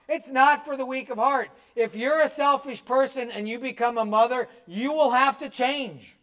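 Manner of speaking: a pastor preaching hard on motherhood; fervent preaching. Acted. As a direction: drive the point home to the congregation. Preaching at full force.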